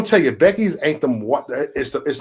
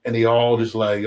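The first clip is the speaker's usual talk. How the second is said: ordinary delivery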